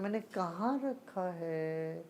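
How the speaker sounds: softly wondering aloud, trailing away; acted searching of memory, slowing then fading; trying to remember. Teacher acting out someone who forgot where it is, musing. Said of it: "कोई चीज़ को याद करना हो".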